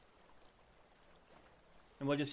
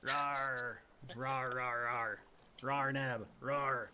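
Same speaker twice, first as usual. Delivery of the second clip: dinosaur voice; 3 semitones lower, much slower than usual. Pretend. Playful roaring at a teammate.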